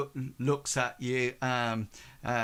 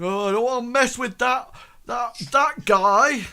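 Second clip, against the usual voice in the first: scared thug; 11 semitones higher, 9 dB louder than usual. Acted. Thug backing off from the mortician, high and frightened. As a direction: back away squealing in fright. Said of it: "He, he sort of".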